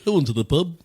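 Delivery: nasal, hammed-up British accent; bad Liverpool-style British accent through a blocked nose. American does it his own way in nasal British accent. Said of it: "You sound like you have a deviated septum".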